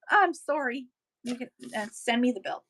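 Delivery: ordinary talk, nothing marked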